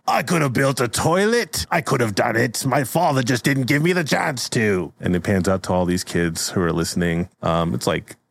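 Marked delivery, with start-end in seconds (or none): 0.1-4.9 put-on high-pitched, aggrieved old-timer; cranky old brother's grievance; bitter old Pork. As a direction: complain like a sore loser, pitched up. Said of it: "he's old and bitter".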